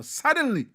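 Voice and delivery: "suddenly" jumps high and loud; sudden emphasis. Real, not acted. Preacher jolting the audience awake, "suddenly" high and loud. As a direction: hit "suddenly" hard and high. Pain becomes personal, urgency.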